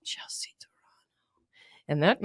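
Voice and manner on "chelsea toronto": whispered right into the ear; breath-only, very soft imitation of a whisperer; creepy whisper. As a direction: imitate a hushed murmur up close. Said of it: "my ear and whispers".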